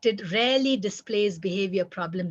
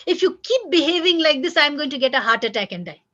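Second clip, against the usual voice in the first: higher start, tumbling fast to the end; guilt-tripping parent; 4 semitones higher, 4 dB louder than usual. Shown as a demonstration. Parent blaming a misbehaving child, guilt-tripping. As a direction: lay on a mock guilt trip. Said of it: "Guilt trip".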